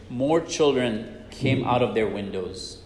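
ordinary delivery

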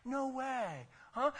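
high mock gasp of disbelief; mock shock. Pastor mocking a friend reacting to gossip, shocked. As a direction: imitate the too-quick sympathizer. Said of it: "Don't you be so quick to say".

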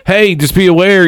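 lightly voiced chummy cop warning. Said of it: "He's like".

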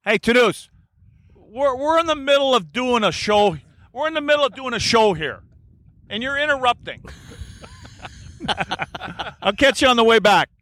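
high, joking call to a friend across the room. Real, not acted. Host jokingly scolds friend for interrupting the show, high playful call. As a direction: playfully shoo off the friend who walks in.

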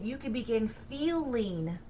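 ordinary talk, nothing marked

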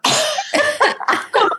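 high burst of laughter; a squealing laugh at herself. Real, not acted. Laughing at her own teenage reaction to Barbizon, high squealing laugh.

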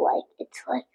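soft unvoiced whisper. Real, not acted. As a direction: breathe it as a hushed aside. Reader's hushed aside.

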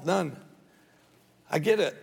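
ordinary talk

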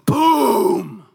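sharp high intake, then a loud drawn-out boom; imitated fiery blast. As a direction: act out a sudden explosion. Imitating fire falling from heaven.

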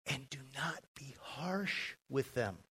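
soft, near-whispered verse reading; hushed. Real, not acted. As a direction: drop to a whisper for the admonition. Preacher softens verse about harshness.

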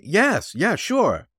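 stammer, then leap high into agreement; halting start bursting into high-pitched assent; sudden eager cry. A narrator's reading. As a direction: blurt it out as if an idea just hit. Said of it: "Henley now cried, as if suddenly hit with an idea".

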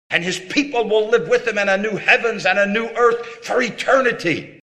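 high, ringing preacher's climax; preaching at full force. Acted. Preacher proclaiming heaven to listeners, full-voiced. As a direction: lift the promise up like a sermon's peak.

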